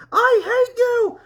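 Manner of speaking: a high, furious, shouted scream; a full-throated scream of rage and grief. Acted. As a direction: scream it at the dead brother in anger. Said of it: "he cried and yelled".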